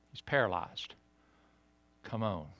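ordinary talk

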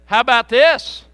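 loud emphatic Jesus, "If" hammered; Jesus turns it back on the father; firm Jesus. Retold or imitated. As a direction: challenge the father firmly. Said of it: "And he said".

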